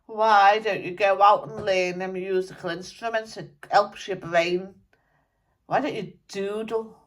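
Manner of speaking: a dead rocker grumbling through a medium; channelled musician spirit. Acted.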